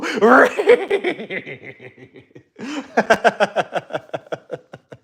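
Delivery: long, high, loud stuttering cartoon laugh; hammed-up Scooby-style giggle trailing on; chattering high cartoon dog noise; cartoon dog cackle. Scooby-Doo impression, cartoon laugh.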